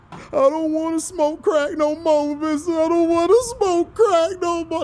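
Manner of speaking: pleading, whimpering student voice; imitating a begging student. Quoting Lean On Me, Sams begging the principal, mock tearful. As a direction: plead like a kid caught out. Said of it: "What did my boy say on Lean On Me?".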